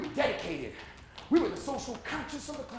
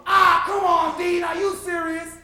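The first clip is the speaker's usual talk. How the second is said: high, loud exasperated heckle; heckling shout; 7 semitones higher, 9 dB louder than usual. Acted. Student heckling the dean from the crowd, loud disbelief.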